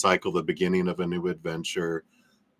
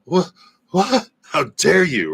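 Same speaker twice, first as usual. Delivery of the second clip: much higher and louder, hammed-up indignation; mock outrage; 10 semitones higher, 6 dB louder than usual. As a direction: play the offended party, scandalised. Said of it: "And it's like".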